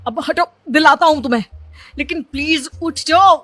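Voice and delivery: high, loud and fast exasperated plea. Acted. Husband begging his sulking wife to stand up, exasperated. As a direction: give in loudly, pleading with her to get up.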